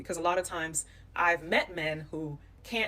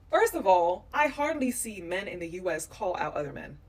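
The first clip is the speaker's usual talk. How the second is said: usual speaking voice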